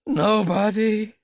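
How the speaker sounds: high, loud, slow; desperate denial. Acted. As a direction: insist in fear.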